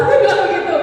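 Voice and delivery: ordinary talk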